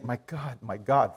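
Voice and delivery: crying plea. Retold or imitated. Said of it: "I was crying and saying".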